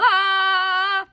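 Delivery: high, loud pained yell; sudden high ah; yelp. Acted. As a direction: cry out from a bitten nose. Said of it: "and she yelled".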